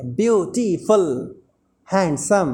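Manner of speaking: "ब्यूटीफुल" slowly as ब्यू, टी, फुल, "हैंडसम" as हैंड, सम; syllable by syllable. Shown as a demonstration. Teacher explains polysyllabic adjectives to learners, syllable by syllable. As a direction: break "ब्यूटीफुल" and "हैंडसम" into slow beats.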